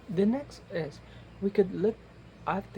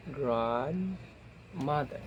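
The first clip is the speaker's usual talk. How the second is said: "Grandmother" drawn out very slowly in two parts; slow reading; much slower than usual. Shown as a demonstration. Teacher showing students how to decode, slow reading. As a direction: stretch "Grand" and "mother" as if decoding it. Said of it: "try to read it slowly".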